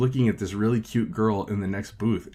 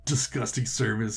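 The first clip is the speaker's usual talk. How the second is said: total ass; 4 semitones higher than usual. Retold or imitated. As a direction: act out an obnoxious customer storming off. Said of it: "he turns into a total ass".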